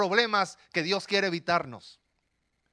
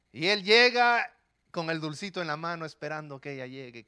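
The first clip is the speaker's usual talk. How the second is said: normal talking voice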